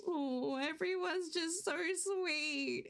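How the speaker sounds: slow, high, tearful thanks; happy cry. Real, not acted. Streamer thanking kind viewers, tearful and happy. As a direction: let the happy tears show. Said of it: "nice to have a happy cry".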